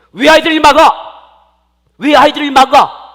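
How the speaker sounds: very loud, high shout; angry rebuke. Acted. Jesus rebukes disciples for blocking children, angry shout. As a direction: thunder at them in acted anger. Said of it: "하면서 예수님이 막 화를 냈어요".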